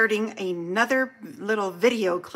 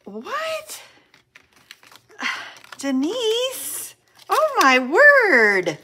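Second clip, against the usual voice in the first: very high, incredulous squeak opening into a drawn-out exclamation; be floored by a friend's gift; excited amazement; 9 semitones higher, much slower than usual. Real, not acted. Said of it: "I'm getting all excited here".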